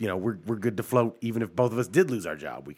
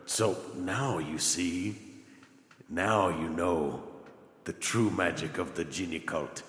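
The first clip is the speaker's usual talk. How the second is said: cult leader voice; 7 semitones lower, 10 dB quieter than usual. Pretend.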